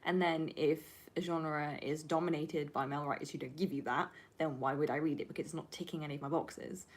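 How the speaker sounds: ordinary talk, nothing marked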